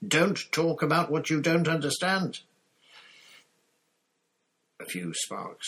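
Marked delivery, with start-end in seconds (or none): 0.1-2.4 furious shout. A narrator's reading. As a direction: snap her into silence. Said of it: "Snape shouted".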